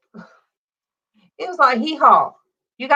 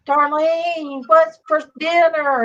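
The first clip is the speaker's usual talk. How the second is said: raised, higher voice calling across the yard; calling out loud; 7 semitones higher, slower than usual. Retold or imitated. Calling out to Darlene from the pool. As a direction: call the question out loud. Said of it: "I'm saying all this loud".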